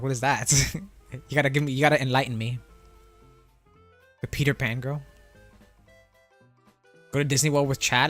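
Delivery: ordinary talk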